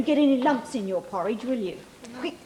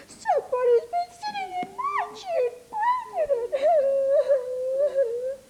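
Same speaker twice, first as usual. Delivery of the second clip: very high, slow, crying tiny bear; crying Baby Bear; 3 dB louder, slower than usual. Acted. Teacher reading to child as Baby Bear, mock crying. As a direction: wail it like a heartbroken wee bear.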